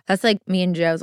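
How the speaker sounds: ordinary talk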